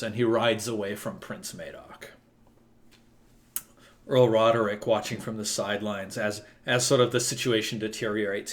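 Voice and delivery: normal talking voice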